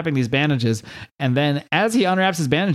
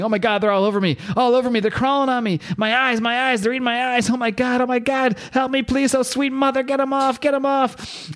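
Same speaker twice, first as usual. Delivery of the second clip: mock scream; 12 semitones higher than usual. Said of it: "he's screaming".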